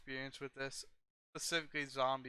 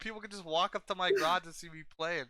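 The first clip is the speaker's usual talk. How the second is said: put-on scared kid; 4 semitones higher, 10 dB louder than usual. Retelling his scared younger self.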